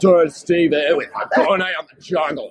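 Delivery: put-on clownish, over-the-top Australian accent; crazy Australian. Narrator mocking own Australian accent, crazy Australian. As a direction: do a hammed-up Aussie adventurer. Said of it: "I sound like a crazy Australian".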